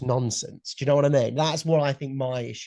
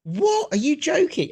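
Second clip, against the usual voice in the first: much higher and louder, "What?" shooting up in shock; stunned disbelief; 14 semitones higher, 4 dB louder than usual. Real, not acted. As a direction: react as if your friend just said something outrageous. Stunned at friend's opinion.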